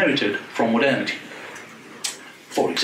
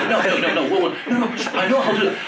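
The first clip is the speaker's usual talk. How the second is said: laughing through a quick run of no's, getting higher; laughing protest; 7 semitones higher than usual. Retold or imitated. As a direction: protest while cracking up. Laughing rebuttal to the journalist.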